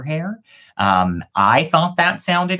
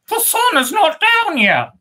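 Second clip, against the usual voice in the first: very high louder bird voice; first bird; 5 dB louder than usual. Acted. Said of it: "said the first bird".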